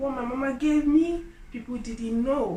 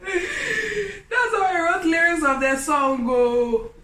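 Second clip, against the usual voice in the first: laughing; 7 semitones higher, 7 dB louder, slower than usual. Real, not acted. Laughing at own story.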